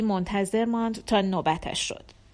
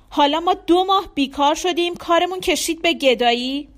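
loud, high-pitched indignant protest; angry outburst; 7 semitones higher, 6 dB louder than usual. A narrator's reading. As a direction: lash out in wounded indignation. Husband's outburst after throwing spoon.

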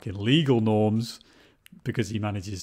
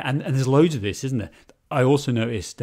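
usual speaking voice

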